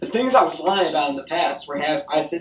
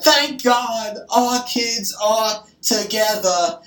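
each word stretched out, higher; slow, deliberate repeat; 6 semitones higher, much slower than usual. Retold or imitated. Repeating the line to a mother who didn't hear, slow and deliberate. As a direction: repeat it slowly so she can hear. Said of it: "I didn't hear you".

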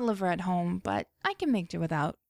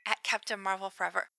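ordinary delivery

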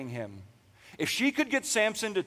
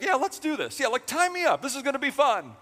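higher, goofy eager voice; mock eager Samson; 8 semitones higher than usual. Preacher mocks Samson happily agreeing, goofy higher voice. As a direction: sound thrilled to be tied up.

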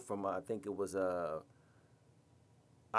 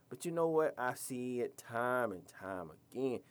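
usual speaking voice

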